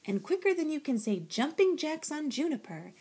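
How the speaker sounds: usual speaking voice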